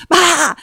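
sudden loud, high "bah!"; mock scare shout. Prankster springing from elevator at colleague, loud mock scare shout. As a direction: jump out and startle someone. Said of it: "I go".

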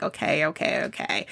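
hushed mock surrender; resigned giving in. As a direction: give in under your breath. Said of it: "I'm like".

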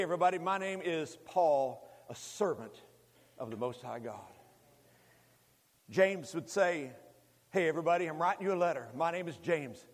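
normal talking voice

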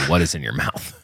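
low stern parent voice. Retold or imitated. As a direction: ask it like a suspicious parent. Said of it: "when I say".

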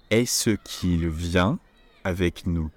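slowed right down, each word set apart; a careful slow model sentence; slow demo. Shown as a demonstration. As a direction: spell it out slowly for learners. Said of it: "teaching a sentence slowly".

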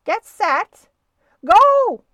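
high, loud start call building to a big GO; referee's starting shout. A narrator's reading. Referee starting the sailboat race, building shout. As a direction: shout it out in stages, loudest on the last. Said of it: "shouted the referee".

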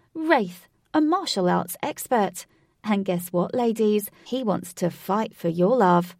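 loud, hyped club emcee; loud showbiz announcement; announcer. Acted. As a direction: whip up the crowd. Katie announces the male acts.

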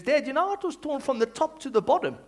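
an eager child quizzing Dad, voiced high; young son. Voices his young son's question.

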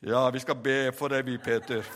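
very low, solemn monotone; mock-pious brother. Brothers offer prayer instead of help, mock-pious deep voice. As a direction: intone it deep and sanctimonious.

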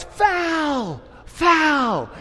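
high, loud outraged "Foul! Foul!"; indignant cry. Retold or imitated. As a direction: cry foul like a cheated man. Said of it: "And Jacob cries".

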